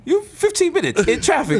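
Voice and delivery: high-pitched navigation app voice; Waze. Acted. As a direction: announce it like a chirpy GPS, pitched well up. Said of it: "you hear the Waze voices like".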